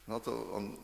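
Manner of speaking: ordinary delivery, nothing marked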